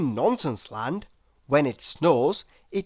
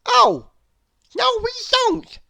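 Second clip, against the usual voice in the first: very high, louder cartoon voice agreeing; Mister Nonsense voice; 6 dB louder than usual. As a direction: squeak out a hearty agreement as the silly character. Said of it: "agreed Mister Nonsense".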